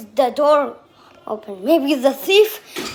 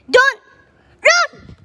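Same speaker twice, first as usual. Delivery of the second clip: panicked high shout; 11 semitones higher, 6 dB louder than usual. Real, not acted. Kid being chased yells to stop, high panicked shout. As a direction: cry it out high and loud.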